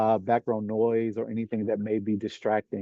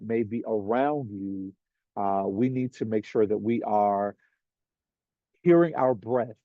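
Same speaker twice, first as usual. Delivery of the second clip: guided meditation. Acted. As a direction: ease the group into the present moment. Leads a grounding meditation.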